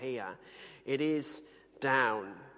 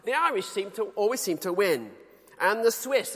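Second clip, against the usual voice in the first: a higher, light imitation of a Yorkshire ploughman; Yorkshire accent; 6 semitones higher, 5 dB louder than usual. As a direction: mimic a Yorkshire man in the pub, pitched up. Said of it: "he said in his Yorkshire accent".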